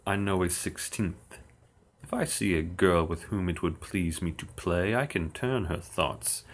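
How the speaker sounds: ordinary talk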